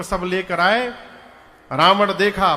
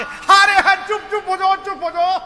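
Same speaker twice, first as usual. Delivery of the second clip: much higher, louder, through roaring laughter; mocking Ravana laughter; 9 semitones higher, 5 dB louder than usual. Ravana laughs Hanuman down, mock guffawing hush. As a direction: cackle it out as the mocking demon king. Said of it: "ठहाके".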